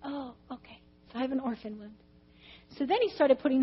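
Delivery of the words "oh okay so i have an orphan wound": very soft, subdued concession; acted meek acceptance. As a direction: give in quietly, as the truth lands. Acts out reluctant acceptance.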